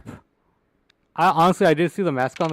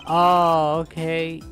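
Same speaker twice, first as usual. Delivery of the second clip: woman's voice; 4 semitones higher, 6 dB louder, much slower than usual. Acted. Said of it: "That's why I did my girl voice".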